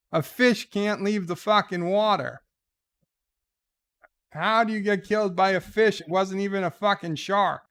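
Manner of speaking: high-pitched, disbelieving rant; incredulous rant. Acted. Host mocks Steve Irwin over the fish, incredulous rant. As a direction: rail in disbelief, voice pitched well up. Mock rant at letter writer.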